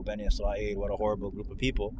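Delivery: ordinary delivery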